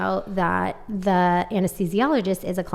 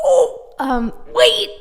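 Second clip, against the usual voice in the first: higher, louder startled gasp; sudden realization; 14 semitones higher, 6 dB louder, much slower than usual. Real, not acted. Remembering her post-anesthesia words, startled gasp. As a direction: it just hit her. Suddenly recalling what she said.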